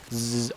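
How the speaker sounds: a low hum like a big fly buzzing; buzzing noise. Retold or imitated. Buzz like a fly to a listener. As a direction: imitate a fly's buzz, soft. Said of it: "they go".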